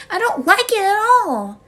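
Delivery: loud, high burst of protest; upset outburst. Acted. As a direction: blurt it out, upset and insistent. Upset child refuses to go back.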